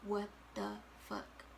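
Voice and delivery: soft, slow, stunned murmur under the breath. Retold or imitated. Stunned quietly at the positive pregnancy test, soft slow murmur. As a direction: let it slip out quietly in shock.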